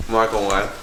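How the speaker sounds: usual speaking voice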